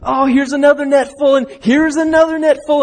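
high, loud delight at each new net; stacking joy on joy as the nets fill; excited. Acted. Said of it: "they're excited and like".

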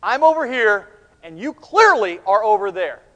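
loud, with "I'm" and "you" punched; emphatic contrast. Acted. Jesus sets himself apart from disciples, emphatic contrast. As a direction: mark the gap, hitting "clearly" hard. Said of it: "he is emphatically pointing out the separation".

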